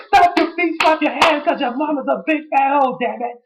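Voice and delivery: high mocking chant; The Rock impression. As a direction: chant the taunt in a cartoon voice. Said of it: "He's like".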